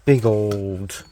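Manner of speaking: normal talking voice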